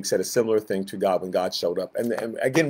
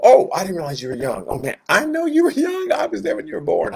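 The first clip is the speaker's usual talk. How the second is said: much higher mock surprise; sarcastic God; 10 semitones higher, 3 dB louder than usual. God mocking Jeremiah's excuse, sarcastic. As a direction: drip with sarcasm, oh, you were young.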